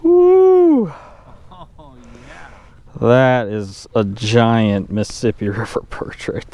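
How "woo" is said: excited whoop. Real, not acted. Huge fish, laughing cheer.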